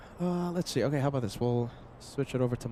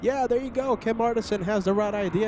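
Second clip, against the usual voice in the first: excited; 6 semitones higher, 6 dB louder than usual. Real, not acted. Host pitching to viewers.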